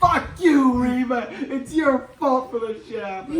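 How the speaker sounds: high-pitched angry yelling, hammed up; play-acted villain tantrum; mock furious blame. Voicing Fifth Brother blaming Reva.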